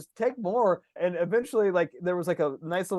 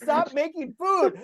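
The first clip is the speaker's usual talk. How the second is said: high, drawn-out protest; mock exasperated plea; 9 semitones higher, much slower than usual. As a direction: plead in comic despair, stretching the words. Retold plea to aunt bringing food.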